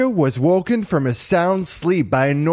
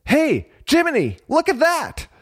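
put-on high-pitched excited boy; sudden exclamation; 13 semitones higher than usual. A narrator's reading. Pinocchio pointing something out to Jiminy, exclaiming. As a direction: exclaim high to grab attention. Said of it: "suddenly he exclaimed".

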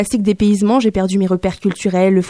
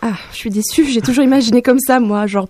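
ordinary talk, nothing marked

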